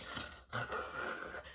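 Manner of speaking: long, rasping mock snore; imitated snore. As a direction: snore like a friend fast asleep. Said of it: "was snoring the whole time".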